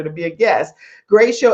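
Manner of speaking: normal talking voice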